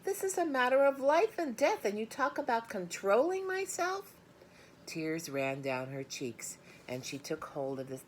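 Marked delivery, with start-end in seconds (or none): 0.0-4.1 put-on high, loud girlish outrage; girl yelling at her father. A narrator's reading. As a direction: shout it as life and death. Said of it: "yelled Fern".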